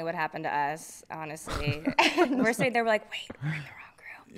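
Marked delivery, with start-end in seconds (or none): 3.1-4.4 whisper. Retold or imitated. Whispering in wrong Zoom group.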